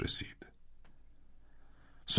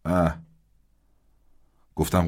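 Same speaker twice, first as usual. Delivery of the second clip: plain reading voice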